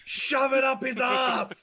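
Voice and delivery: shouting. Real, not acted. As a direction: belt it out in a big laughing shout. Player yells at table.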